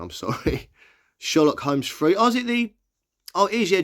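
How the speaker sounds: normal talking voice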